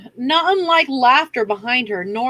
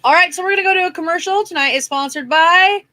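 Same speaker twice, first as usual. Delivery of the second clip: announcer voice; 6 semitones higher, faster than usual. Pretend. Mock commercial announcement.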